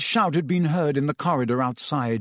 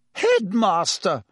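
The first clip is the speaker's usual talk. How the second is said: high, drawn-out indignant protest; sputtering; 7 semitones higher, 3 dB louder, slower than usual. A narrator's reading. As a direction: sputter in outrage at the headmaster. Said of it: "sputtered Madame Pomfrey".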